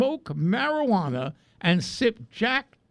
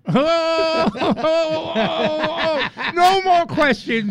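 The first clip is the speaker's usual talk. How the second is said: high, loud wailing protest; mock-horrified outcry; 12 semitones higher, 8 dB louder, slower than usual. Host stops the guest's rooster joke, loud mock-horrified outcry. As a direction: cry out over the punchline and shut it down.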